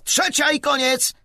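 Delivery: high, loud call across the field; announcing the play. A narrator's reading. Spencer announces the last play to teammates, calling out. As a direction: call it out to the players. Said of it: "oznajmił Spencer".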